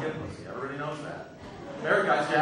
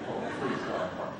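muttered prayer; 12 semitones lower, 8 dB quieter than usual. Retold or imitated. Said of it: "I'm like".

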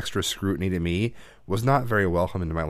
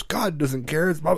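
higher-pitched mock lecture; retold scolding, not shouted; dad freaking out; 7 semitones higher than usual. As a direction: imitate an exasperated father's rant. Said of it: "like, freaking out on me".

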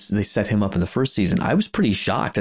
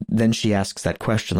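usual speaking voice

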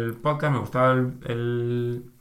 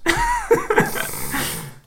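very high laugh; high squeal of laughter. Real, not acted. Laughing at the viewer's shirt comment. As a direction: burst into laughter.

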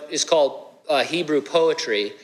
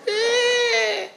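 mock child whine. Children whining, voice gets crazy.

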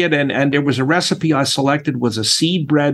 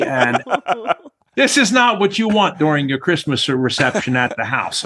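normal talking voice

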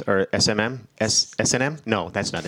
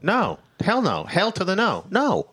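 indignant; 8 semitones higher, slower than usual. Real, not acted. Outraged refusal.

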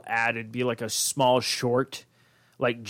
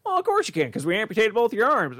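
much higher mock-breezy doctor; 7 semitones higher than usual. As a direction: toss it off like a cheery cartoon doctor. Doctor voice in retold joke.

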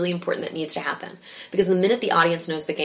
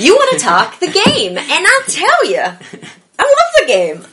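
big, loud, high declaration; excited; 12 semitones higher, 11 dB louder, slower than usual. Real, not acted. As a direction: proclaim it with passion. Enthusiastic declaration of love for game.

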